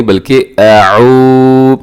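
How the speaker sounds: अऊ held long and melodic. Shown as a demonstration. Teacher showing students the long अऊ. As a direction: stretch अऊ out. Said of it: "अऊज़ु नहीं".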